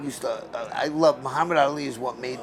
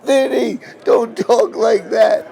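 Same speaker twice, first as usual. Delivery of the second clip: hammed-up wail, high, loud and drawn out; mock bawling; 9 semitones higher, 10 dB louder, slower than usual. Boxer imitates his crying mother pleading with him, mock bawling. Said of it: "she just started crying. She started bawling".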